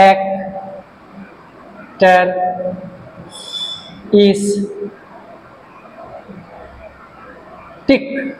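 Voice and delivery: Car, এক, ter, is, tic with long pauses; syllable by syllable. Shown as a demonstration. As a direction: dictate characteristic very slowly, one syllable at a time. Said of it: "পাঁচটা syllable আছে".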